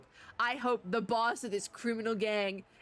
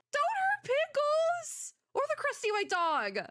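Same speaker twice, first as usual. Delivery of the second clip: alarmed high plea; 14 semitones higher than usual. Real, not acted. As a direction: beg them to spare the dog. Protesting villain threat to dog.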